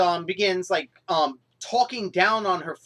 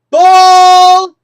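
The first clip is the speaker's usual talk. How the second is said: much higher and far louder cry of bull; outraged yell; 9 semitones higher, 18 dB louder than usual. Real, not acted. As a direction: scream it in protest. Rejecting the excuse.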